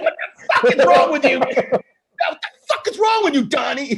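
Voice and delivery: hammed-up bellow at a friend; mock shout. Retelling Goodman yelling at Donnie in the movie: hammed-up shout. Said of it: "he just".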